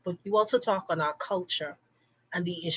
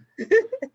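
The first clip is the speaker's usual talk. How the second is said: high, loud giggle; a bright little laugh; 8 dB louder than usual. Real, not acted. Host laughing as she invites final comments, a high giggle.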